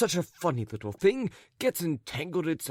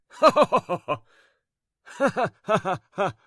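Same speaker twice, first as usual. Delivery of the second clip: louder spoken ha ha, fading; ha ha laugh; 5 semitones higher, 5 dB louder, slower than usual. A narrator's reading. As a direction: let him finally laugh. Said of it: "Ivan Andreyich began laughing at last".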